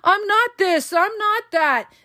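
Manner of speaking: a hammed-up defensive outburst; mock yell. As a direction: imitate someone yelling they're not crazy. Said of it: "yelling and screaming with them".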